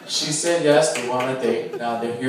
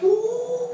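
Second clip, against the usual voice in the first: mock clarinet ooh. Imitates brother's clarinet.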